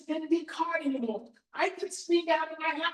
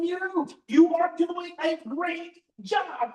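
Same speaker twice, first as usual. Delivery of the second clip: slow, earnest, firm comfort; slow reassurance; 2 semitones higher, 4 dB louder, slower than usual. Real, not acted. To worried parents at a seminar, slow earnest reassurance. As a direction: lay each word down slowly so they believe it.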